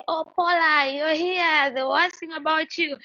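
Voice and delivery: high-pitched mock-delighted commenter; quoted fan's high exclamation; imitating excited greeting. Voicing a commenter's greeting in retelling.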